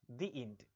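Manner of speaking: "The" said "dee" before end. Shown as a demonstration. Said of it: "इसीलिए क्या बोलेंगे".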